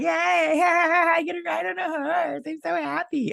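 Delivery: very high, squealed mock joy; mock glee. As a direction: imitate a silly, overjoyed rider way up high. Mocking how stupid the rider looks.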